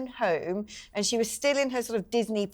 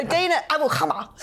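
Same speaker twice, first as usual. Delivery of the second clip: 1 mock BBC Arabic accent; 6 semitones higher, 4 dB louder than usual. Shown as a demonstration. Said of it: "let me do that in my B B C accent".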